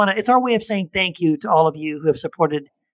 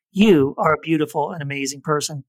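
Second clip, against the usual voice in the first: ordinary delivery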